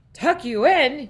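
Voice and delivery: loud, high outraged bellow. A narrator's reading. Captain refuses to tuck the boy in, outraged bellow. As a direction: bellow it in disbelief. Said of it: "he bellowed".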